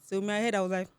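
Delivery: ordinary talk, nothing marked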